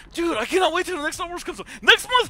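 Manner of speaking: loud, high real excitement; pumped up. Real, not acted. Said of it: "Oh, I am so pumped up".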